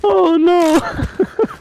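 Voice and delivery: high stunned "oh no"; shocked. Real, not acted. Reacting to the snipe.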